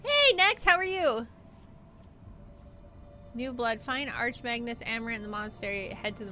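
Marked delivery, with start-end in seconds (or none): 0.0-1.3 cheery greeting. Pretend. Greeting a character in voice.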